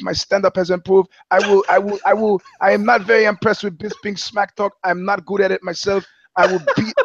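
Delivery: GSP hyping his fight in a hammed-up French Canadian accent. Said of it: "French Canadian accent".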